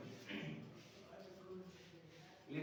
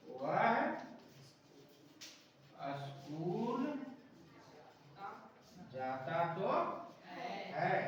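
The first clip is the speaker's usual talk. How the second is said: slow dictation; 4 dB quieter, much slower than usual. Shown as a demonstration. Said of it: "अगर हम कहना चाह रहे हैं".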